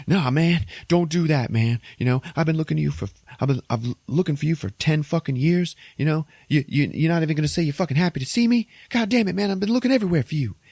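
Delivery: high, fast, pleading outburst from the brother; he's hurt and angry, rushing his words; an agitated younger brother, voice up high and fast; upset brother. Retold or imitated. Brother's angry emotional reunion.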